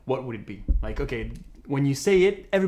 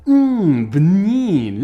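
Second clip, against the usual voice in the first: loud mmm then بنين; savoring yum; 4 semitones higher, 9 dB louder, much slower than usual. Shown as a demonstration. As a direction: relish the food, say بنين with delight. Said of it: "Mm, it's delicious".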